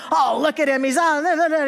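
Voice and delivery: high, spluttering mock driver's rant; imitated fit. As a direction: act out an exasperated fit, spluttering into nonsense. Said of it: "having a big fit".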